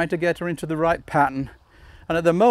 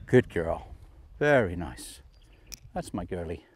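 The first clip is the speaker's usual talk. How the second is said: tender dog praise; 12 semitones lower, 8 dB quieter than usual. Real, not acted. Trainer praising her dog after a sit, low soft coo. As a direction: lower and much softer, cooing.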